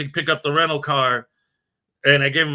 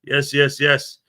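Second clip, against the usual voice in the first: normal talking voice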